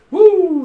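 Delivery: high, loud "woo"; whoop. Real, not acted. Reader reacting to the cards, loud high whoop.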